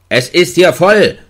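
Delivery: laut und höher gegen die Tür gerufen; lärmend. A narrator's reading. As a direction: mach Lärm gegen den Schlüsselbund. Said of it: "lärmte ich". (Gefangener lärmt gegen die Tür, laut gerufen)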